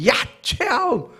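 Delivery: high, drawn-out scolding yell; angry outburst. Retold or imitated. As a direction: reenact a dad losing his temper at his daughter. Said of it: "제가 화를 버럭 냈어요".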